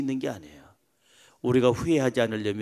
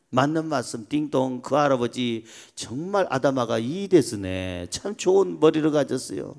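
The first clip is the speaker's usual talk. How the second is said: ordinary delivery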